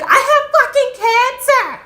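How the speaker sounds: very high, loud acted-out scream; screamed revelation. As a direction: shriek the shocking news to stop a fight. Friend's outburst in acted fight.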